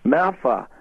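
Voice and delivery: put-on Boston kid letting words drop out with the R's gone; lazy Boston kid. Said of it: "let words drop out of it".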